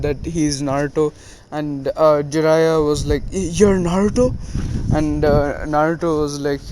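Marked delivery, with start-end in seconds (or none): 3.3-4.3 higher, stammered question rising at the end; stunned disbelief. Retold or imitated. Jiraiya learns who the boy is, stammering in disbelief. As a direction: stumble on the first word in mock shock. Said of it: "Jiraiya seemed really scared".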